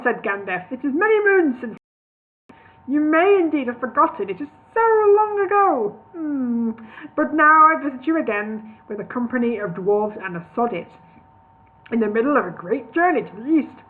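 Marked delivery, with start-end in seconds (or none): 0.7-8.8 high, hammed-up wistful plea; mock lament. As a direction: overplay the nostalgic sorrow. Gandalf wistful plea, high cry.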